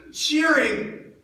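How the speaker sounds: building from loud to a high outraged shout; rising outraged cry. Acted. Disciple remembering the crowd cheering the crucifixion, outraged cry building. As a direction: let the disbelief swell into a cry.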